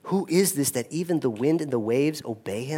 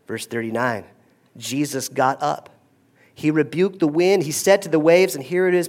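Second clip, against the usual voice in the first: ordinary delivery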